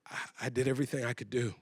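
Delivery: hushed, low and halting; subdued, heavy-hearted thinking aloud; despairing. Retold or imitated. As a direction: imitate a mother quietly giving up. Mom despairing, short of money.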